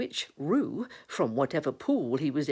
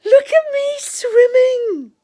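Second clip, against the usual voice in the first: high, loud squeal; drawn-out, delighted child's squeal; Roo; 15 dB louder, slower than usual. Acted. Roo showing off from the pool, high gleeful squeal. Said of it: "squealed Roo".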